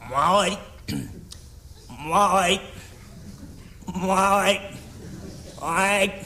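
lightly croaking frog-like call; a hint of a frog croaking for customers. The gatekeeper calls in customers in a lightly croaking frog voice. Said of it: "カエルみたいな声出して".

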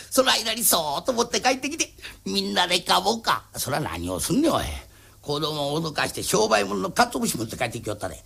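ordinary delivery

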